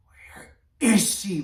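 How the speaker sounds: loud, slow roar. Acted. King demands Asha be brought, roaring loudly. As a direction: bellow the question at the guards. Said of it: "roared the king".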